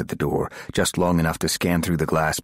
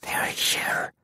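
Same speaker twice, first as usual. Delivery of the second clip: voiceless, hissed warning; hissed whisper; 5 dB quieter than usual. Acted. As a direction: hiss it out in fear. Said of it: "she hissed".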